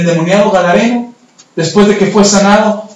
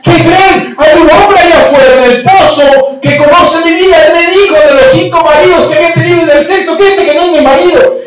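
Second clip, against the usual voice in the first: high-pitched excited public announcement; breaking astonishing news at a high-pitched shout; 7 semitones higher than usual. Acted. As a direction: shout it out to the whole town. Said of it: "empezó a gritar".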